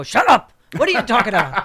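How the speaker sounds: Bernie Sanders impression. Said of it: "Bernie Sanders is gonna wander into it and go".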